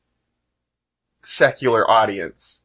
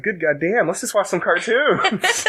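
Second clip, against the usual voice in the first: exasperated; 8 semitones higher than usual. Retold or imitated. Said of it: "So I was just like".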